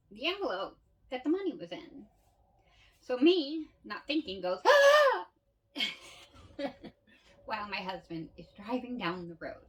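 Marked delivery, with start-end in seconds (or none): 4.6-5.3 sudden high shriek of surprise; loud, high gasped scream; startled shriek. Acted. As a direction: yelp out as the money is spotted. Sudden scream finding the money.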